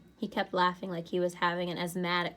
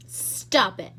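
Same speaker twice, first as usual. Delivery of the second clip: much louder, sharp cry to make him stop; shouted; 9 dB louder than usual. A narrator's reading. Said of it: "she shouted".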